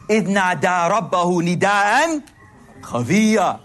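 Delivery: rapid melodic recitation of the verse; quick Quran recitation. Acted. Preacher reciting the verse to the crowd, quickly chanted.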